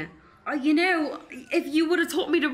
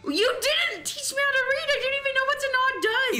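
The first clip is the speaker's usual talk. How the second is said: high, indignant outburst, much higher; outraged cry; 12 semitones higher than usual. Acted. The dancer shouts back that nobody taught her. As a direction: fling it back in fury.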